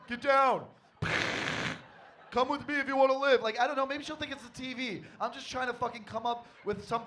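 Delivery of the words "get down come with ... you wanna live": action hero. Retold or imitated. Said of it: "started making noises".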